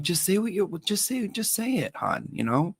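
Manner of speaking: high, soft coaxing "just say it"; coaxing plea. Retold or imitated. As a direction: gently urge him to open up. Coaxing boyfriend to speak.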